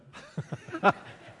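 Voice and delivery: a quick unvoiced chuckle; short breathy laugh. Real, not acted. Patient laughing at his answer to the doctor, short breathy laugh. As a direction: laugh it off under your breath.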